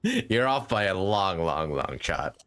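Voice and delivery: usual speaking voice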